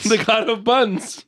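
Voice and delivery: slow amused echo. Retold or imitated. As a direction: repeat it slowly with relish. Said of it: "echoes with delight".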